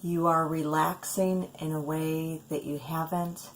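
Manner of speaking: soft, measured sleep-meditation voice with long pauses; calm, unhurried guidance, leaving space between phrases; guided meditation. Acted. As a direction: ease a listener gently down towards sleep.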